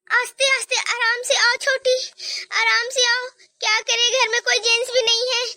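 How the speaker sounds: ordinary talk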